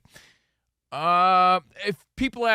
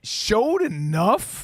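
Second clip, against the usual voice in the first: mocking doubt; 5 semitones higher, much slower than usual. Mocking the coach's words.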